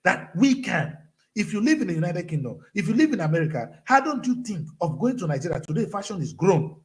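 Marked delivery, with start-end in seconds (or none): none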